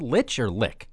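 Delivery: "Lich" with ch, then "Lick" with k; two pronunciations contrasted. Shown as a demonstration. Unsure host asking co-host which is right, "Lich" with ch, "Lick" with k. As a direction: set a ch ending on "Lich" against a hard k on "Lick". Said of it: "how to pronounce that".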